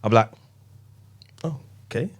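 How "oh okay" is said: soft, higher, hesitant acceptance; taken-aback murmur. Retold or imitated. Dad gets a random hug from his son, quietly taken aback. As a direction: imitate being quietly surprised by affection.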